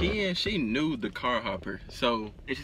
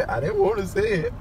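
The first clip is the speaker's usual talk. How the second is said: mock quote; 5 semitones higher, 4 dB louder, faster than usual. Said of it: "She said".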